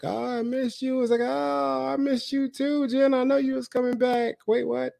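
high, mocking voice of Jen; Jen in my head. Parody of Jen's homecoming greeting, voiced high. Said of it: "that's how she talk".

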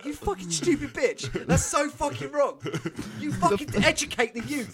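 high-pitched indignant rant; mock outrage. Inner rant at a teacher who misquoted, mock outrage.

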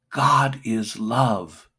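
booming, drawn-out declaration; slow loud proclamation. Real, not acted. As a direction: proclaim it to the whole church. Preacher proclaims the core message.

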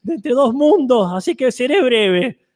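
high, fading voice from beyond; a grandmother spirit slipping away; ghostly old woman. Acted. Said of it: "Una voz de ultratumba de una anciana".

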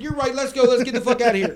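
high, loud retold alarm; urgent retreat. Friend runs back up from the basement, loud urgency. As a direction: blurt it out loud as if bolting.

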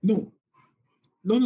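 normal talking voice

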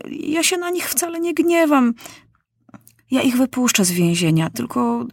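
put-on high, eager childish voice; generous young king, bright and quick; King Maciuś promising the doctor to free the prisoners; boy king's voice. A narrator's reading.

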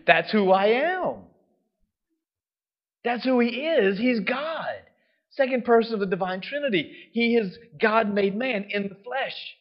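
high, loud preaching voice; emphatic proclamation. Real, not acted. Preacher declaring to the congregation who Jesus is, emphatic proclamation. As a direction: raise pitch and volume to declare it boldly.

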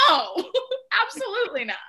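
high, laughing "absolutely not"; laughing chill refusal. Retold or imitated. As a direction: toss it off through a laugh. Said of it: "plays it off so chill".

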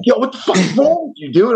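high, incredulous yell-like quote; shocked outburst. Retold or imitated. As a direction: act out friends' disbelief. Friends react to chopper win.

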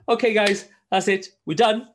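high, loud mock therapist calling time; brightly ending the session; mock wrap-up. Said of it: "she's like".

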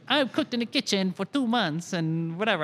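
a high, loud mock Italian accent. Recruiter mocking a casual job seeker in a mock Italian accent. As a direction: toss off the line in a hammed-up Italian accent. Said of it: "I don't know why he has an Italian accent".

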